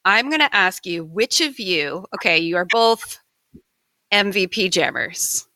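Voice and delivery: ordinary talk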